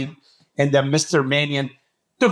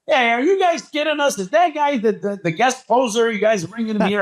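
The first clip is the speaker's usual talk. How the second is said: retold scream; 7 semitones higher than usual. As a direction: call out in mock outrage, pitched up. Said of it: "so I screamed".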